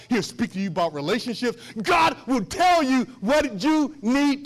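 loud, emphatic sermon delivery; full-force preaching. Real, not acted. Preacher urging listeners to seek God alone, full-force preaching. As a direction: drive each promise home to the congregation.

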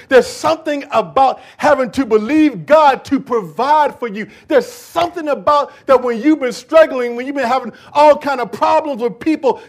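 loud, high preacher's build; loud, rising sermon delivery; preaching at full force. Acted. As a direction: lift the congregation with rising fervor.

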